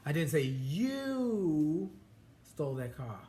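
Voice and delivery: "you" stretched long and lifted, then a pause; stress on YOU. Shown as a demonstration. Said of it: "Emphasis on different words using the same sentence".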